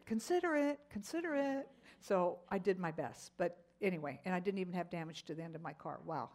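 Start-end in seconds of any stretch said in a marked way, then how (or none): 0.0-1.7 higher, light repeated nudge; voice of the Lord prompting. Retold or imitated. The Lord reminding the driver, light high prompting. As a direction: lightly chant a gentle reminder. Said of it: "the Lord said".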